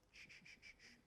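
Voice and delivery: unvoiced soothing shushes. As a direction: hush her gently like a crying child. Soothing shushing of the upset wife.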